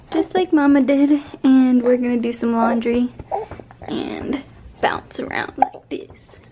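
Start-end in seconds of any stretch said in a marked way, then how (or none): 3.8-6.4 slow whispered aside; whispering. Real, not acted. Whisper while bouncing.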